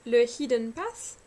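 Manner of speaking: slow, careful English accent on Hidden Path; self-mocking English accent for a French teacher; proud English accent. Shown as a demonstration. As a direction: show off a showy English accent. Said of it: "vous avez vu mon superbe accent".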